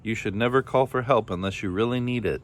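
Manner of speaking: plain reading voice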